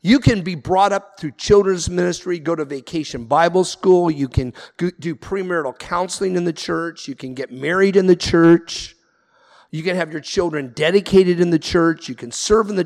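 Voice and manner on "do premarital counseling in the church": ordinary delivery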